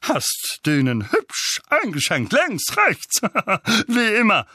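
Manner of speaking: slow start, then high, loud gusto with a laugh; Onkel Vernon, boastful. Acted. Uncle cheers on his boxer son, boastful. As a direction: relish every punch, chuckling proudly.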